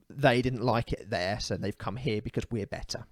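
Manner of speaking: ordinary talk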